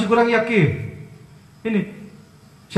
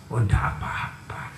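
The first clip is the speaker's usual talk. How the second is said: 1 jawaban Sunan yang lembut; 9 semitones lower, 5 dB quieter than usual. Retold or imitated. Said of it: "Sunan Kalijaga diprotes".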